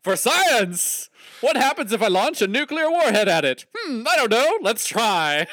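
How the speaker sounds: high, manic mad-scientist voice; Asher impression. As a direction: play the gleeful reckless scientist. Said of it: "First thing he did was shoot".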